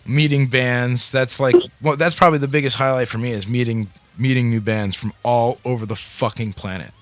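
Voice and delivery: usual speaking voice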